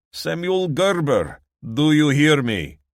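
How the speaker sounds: loud, high Eastern European shout. A narrator's reading. Dimitri shouts up to the house, accented bellow. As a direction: bellow up at the window.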